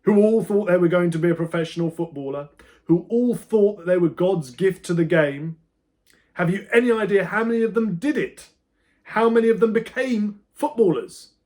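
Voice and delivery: loud, high, building rant; teacher berating a pupil about football dreams; furious teacher. A narrator's reading. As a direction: build the anger line by line. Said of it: "Pratley continued".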